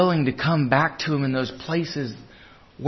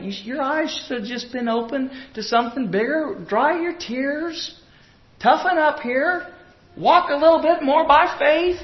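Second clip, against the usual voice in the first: much higher pitched pep talk; breezy dismissal; 10 semitones higher than usual. Acted. Imagined Jesus brushes off mourners, high breezy dismissal. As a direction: chivvy them along in a high voice.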